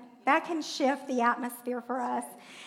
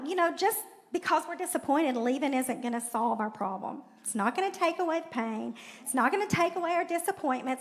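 ordinary talk

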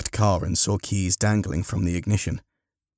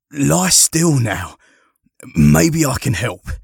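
louder, higher, drawn-out urging; firm reassurance; 6 semitones higher, 8 dB louder, slower than usual. Acted. Harry to the injured man, loud urgent reassurance. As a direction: urge a hurt man to stay put.

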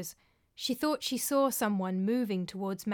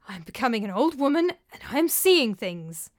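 louder, exasperated self-scolding; self-reproach; 3 semitones higher, 7 dB louder than usual. A narrator's reading. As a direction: scold yourself out loud. Old nurse doubting her own eyes.

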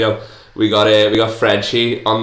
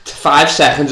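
high, laughing explanation; 7 semitones higher, 3 dB louder than usual. Real, not acted. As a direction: still giggling while talking.

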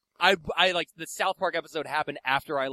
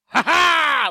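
loud, high mock cackle; triumphant laugh; 13 semitones higher, 12 dB louder than usual. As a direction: crow with a sudden high "ha ha". Triumphant punchline of moon race.

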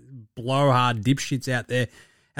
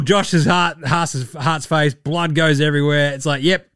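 ordinary talk, nothing marked

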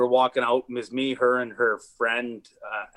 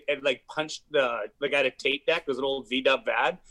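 ordinary talk

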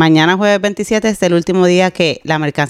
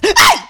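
sudden high shriek of fright; a real jump-scare yelp; startled scream; 3 dB louder than usual. Real, not acted. Cohost startled by a falling microphone, shrieks.

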